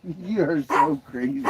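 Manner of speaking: ordinary talk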